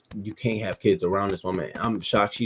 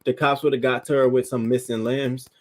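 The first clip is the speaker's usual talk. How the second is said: normal talking voice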